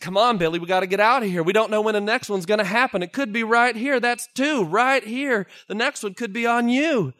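high, loud pleading to leave; urgent yelling. Acted. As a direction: yell at him to get out now. Miners yelling at Billy to leave.

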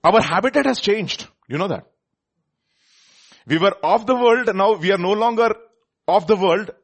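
high, loud, declaring preacher; forceful preaching. Real, not acted. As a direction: proclaim it to the congregation.

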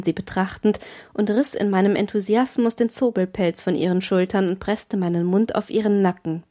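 usual speaking voice

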